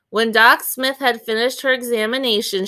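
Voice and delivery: plain reading voice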